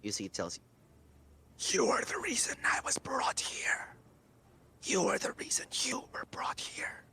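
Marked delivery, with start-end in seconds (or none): 1.7-7.1 whispered, high demonic entity. Acted. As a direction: breathe it like an ancient demon. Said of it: "I'm gonna have to do a demonic voice now".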